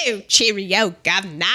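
hammed-up amateur Cockney; bad Cockney. Mocking the woman's accent for co-host, bad Cockney. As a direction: do a badly faked Cockney. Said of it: "doing a Cockney accent".

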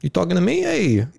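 high, hammed-up New York Italian accent; tough-guy Italian American, played for laughs. Friend mocking Peter's dad in a hammed-up Italian-American accent. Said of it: "you're giving my dad, like, this strong Italian accent".

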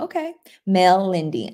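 ordinary talk

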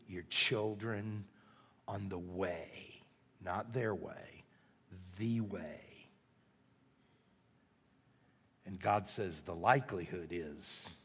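hushed, low preaching with long pauses; slow the appeal right down, soft and low; solemn sermon close. Real, not acted.

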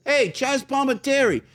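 high-pitched calling out; retold yell. Stranger calling from a van, high mock yell. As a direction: mimic a passerby hailing a star. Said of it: "Somebody yells from a van".

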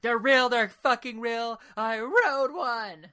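loud, high, slow insistence; indignant shouting. Retold or imitated. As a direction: shout it, offended to the core. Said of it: "She's like".

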